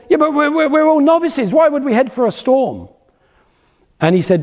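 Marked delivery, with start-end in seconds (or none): 0.1-2.9 much higher, anxious protest; nervous novice. Retold or imitated. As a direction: play the jittery beginner objecting to the plan. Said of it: "a nervous laugh from the guy".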